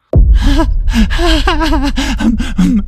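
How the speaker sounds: loud, high manic laugh; long unhinged cackle, acted; manic laughter. A narrator's reading. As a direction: burst into crazed high-pitched laughter. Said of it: "hij begint manisch te lachen".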